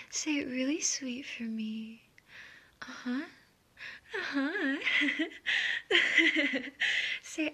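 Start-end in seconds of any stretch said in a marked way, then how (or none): 4.8-7.2 louder, higher teasing laugh. Acted. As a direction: let out a long mocking laugh. Teasing the submissive listener.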